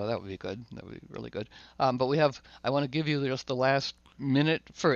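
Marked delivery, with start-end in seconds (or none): 0.0-1.5 calm reply. Retold or imitated. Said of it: "So I go".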